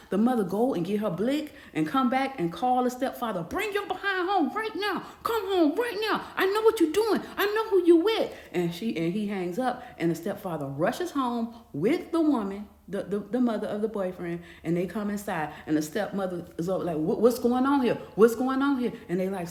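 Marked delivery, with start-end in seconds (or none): 3.4-8.3 much higher and louder, in a put-on scolding voice; furious yelling. Mother ordering the cheating stepfather home, furious yelling. 17.0-18.9 higher and louder, confronting; angry demand. Retold or imitated. Stepmother confronting the couple who walk in, angry demand.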